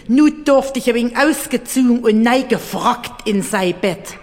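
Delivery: usual speaking voice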